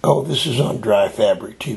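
ordinary talk, nothing marked

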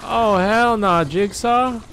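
high, loud outburst; loud real alarm; hell nah. Real, not acted. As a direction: yell it in disbelief. Sees creepy boss.

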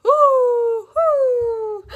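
high, loud owl calls; long imitated owl hoots. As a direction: hoot out like owls calling from afar. Owl siblings calling in the story.

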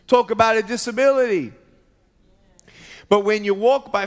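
loud, high preaching build; impassioned preaching. Acted. Preacher to the congregation, impassioned preaching. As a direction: preach it out to the crowd.

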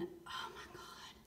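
whispered awe while straining to film; hushed whisper. Real, not acted. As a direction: murmur it under the breath. Whispering while filming awkwardly.

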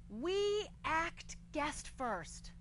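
very slow, each word set apart; word-by-word quote. Retold or imitated. Comic quoting a restaurant sign to the crowd, slow and deliberate. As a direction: read the sign out one deliberate word at a time. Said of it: "and I quote".

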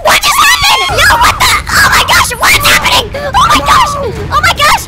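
high, loud disbelieving outburst; shocked yelling. Real, not acted. Dad stunned by what just happened, shocked shouting.

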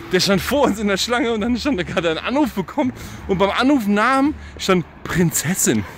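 usual speaking voice

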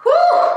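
very high rising whew; whew, it's hot. Real, not acted. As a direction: puff out from the heat. Getting hot during squats.

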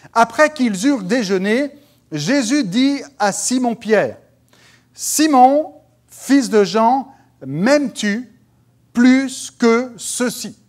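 high, loud, slow proclamation; solemn scripture reading. Acted. Preacher reads Jesus questioning Simon to the congregation, proclaimed loudly. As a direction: proclaim the verse phrase by phrase. Reads Bible passage aloud.

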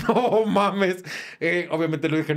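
ordinary talk

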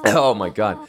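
ordinary talk

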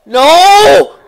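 high, loud cry; drawn-out loud protest; shouted refusal. Real, not acted. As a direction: yell it back at the game. Refusing game's retry prompt.

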